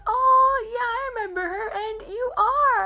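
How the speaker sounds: high, loud gush of recognition; delighted recognition. Retold or imitated. Actor's delighted recognition retold.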